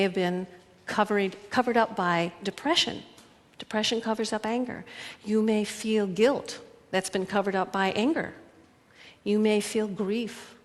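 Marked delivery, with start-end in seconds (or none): none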